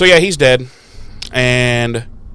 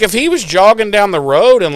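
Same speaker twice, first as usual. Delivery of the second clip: ordinary talk, nothing marked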